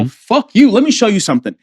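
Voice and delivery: defiant retort. Retold or imitated. Grown man says you don't got it, defiant retort. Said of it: "he challenged me".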